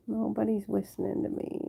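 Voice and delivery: small, hushed lament; soft complaint. Real, not acted. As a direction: grumble it quietly to yourself. Repeats the complaint softly to herself.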